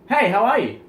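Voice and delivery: loud and high, full of energy; loud excited greeting. Shown as a demonstration. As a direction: burst in with a big hello. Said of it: "Now ready?".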